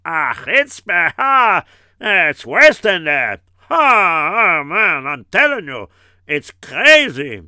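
loud, slow, scandalized exclamations; loud scandalized gossip; loud outrage. Acted. Outraged gossip about the chutzpah.

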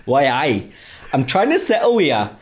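hammy wandering Geordie voice; Geordie accent. Acted. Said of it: "should I do it in a Geordie accent?".

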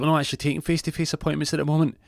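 high, fast receptionist voice; put-on phone receptionist, brisk and high.

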